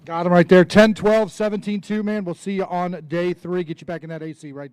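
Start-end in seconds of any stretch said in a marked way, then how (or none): 0.0-2.0 booming weight call. Real, not acted. Announcing weight to crowd.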